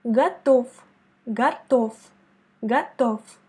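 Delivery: готов ending in F, gatof, stressed on the second O; final V as F. Shown as a demonstration. Teacher repeats готов for student, ending with F. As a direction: end готов with a hard F. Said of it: "comme F".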